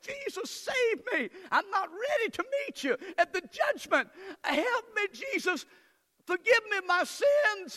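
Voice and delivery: high, loud tearful plea, slow in places; crying prayer. Retold or imitated. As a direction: cry out to Jesus as the boy did. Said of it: "I cried out to Jesus".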